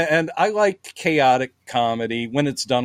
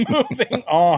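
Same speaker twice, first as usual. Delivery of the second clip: laughing echo; 8 semitones higher than usual. Retold or imitated. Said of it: "And they're like".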